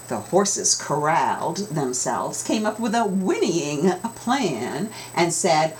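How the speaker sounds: ordinary delivery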